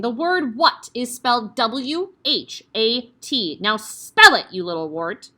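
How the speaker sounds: slow, spelled out, then a very loud blast; shouting. Acted. Headmistress shouts a spelling lesson at the dangling boy. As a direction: roar the order at him. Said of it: "The Trunchbull was shouting".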